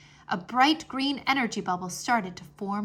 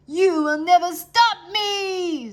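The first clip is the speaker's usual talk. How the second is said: slow, high, loud villain's roar; 9 semitones higher, 9 dB louder, slower than usual. Acted. Wizard dragon defying the children, roaring slowly. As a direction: roar it at the heroes in triumph. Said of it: "Zoltan roared".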